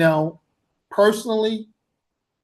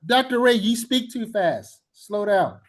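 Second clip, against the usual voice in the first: higher-pitched student voice; complaining student; 5 semitones higher than usual. Retold or imitated. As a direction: mimic a student complaining to the teacher. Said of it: "Sometimes students say".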